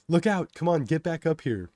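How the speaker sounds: loud, high warning yell. A narrator's reading. Passengers trying to get him off the tracks, urgent yelling. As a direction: yell urgently across a platform. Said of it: "Other passengers yell".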